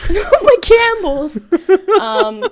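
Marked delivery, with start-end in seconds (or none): 0.1-1.4 high, slow, laughing mock wail. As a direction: echo the joke in a hammed-up whine. Repeats joke louder, laughing.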